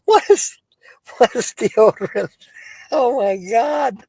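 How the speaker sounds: high, loud, laughing squeal. Real, not acted. Host cracks up on air, squealing with laughter. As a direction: squeal it through giggles.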